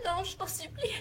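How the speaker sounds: high, frightened cry for help; terrified pleading. Acted. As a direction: beg for mercy in panic. Victim begs attacker to stop.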